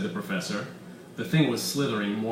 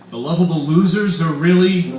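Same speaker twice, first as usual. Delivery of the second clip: shocked fan; 6 semitones higher, 11 dB louder than usual. Acted. Bull shocked.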